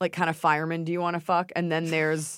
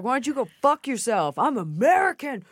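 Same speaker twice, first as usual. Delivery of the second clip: high mock-indignant comeback; retold angry retort; 6 semitones higher than usual. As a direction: fire back at the heckler, mock outrage.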